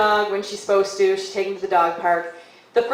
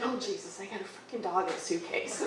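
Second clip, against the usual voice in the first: much softer, panicky aside; secret inner thought; 12 dB quieter than usual. Retold or imitated. Storyteller voices the sitter's secret worry while being helped, hushed. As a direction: drop your volume for a private thought. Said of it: "The whole time she's like".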